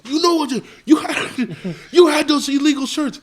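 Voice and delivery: high-pitched mock indignation; retold outraged accusation, voice jumping up; pissed officer. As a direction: imitate an officer's exasperated accusation. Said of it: "he's just pissed".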